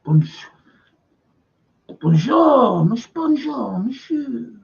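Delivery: delighted, rising French welcome with a lilt; French host greeting. Retold or imitated. Bistro owner welcoming old English customers, a lightly voiced delighted French greeting.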